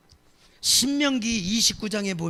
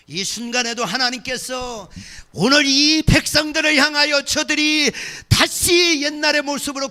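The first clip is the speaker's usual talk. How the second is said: loud fervent preaching, shouting God's plea; preaching at full force; 4 semitones higher, 9 dB louder than usual. Acted. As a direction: cry out God's call to come back. Preaching God crying out.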